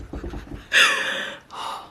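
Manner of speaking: sharp breathless gasp; a quick thrilled intake of breath; excited gasp. Real, not acted. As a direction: gasp in delight. Opening the new phone.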